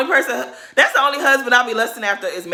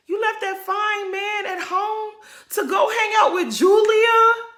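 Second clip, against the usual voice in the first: mock outraged cry; 11 semitones higher, slower than usual. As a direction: cry out at Gertie in hammed-up outrage. Scolding Gertie in mock outrage.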